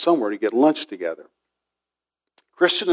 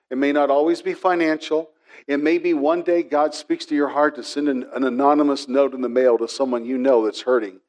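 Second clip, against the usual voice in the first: normal talking voice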